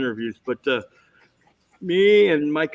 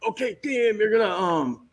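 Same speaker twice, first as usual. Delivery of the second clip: a hint of a higher director voice; director's instructions; 7 semitones higher than usual. Director briefing an actor on set, lightly imitated, higher. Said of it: "and he goes".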